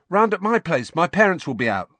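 high, loud reply to mates; gang lad calling out. A narrator's reading. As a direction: pipe up keenly to the group. Gang member calling out plans.

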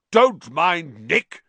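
slow, high, hearty shout. A narrator's reading. Sir Patrick's head calling from the floor, shouting. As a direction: call it out loud, drawn out. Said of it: "shouted Sir Patrick's head from the floor".

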